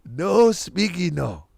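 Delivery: slow put-on Spanish accent; joking fake Spanish accent. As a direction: stretch it in a mock Spanish accent. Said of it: "with a fake Spanish accent".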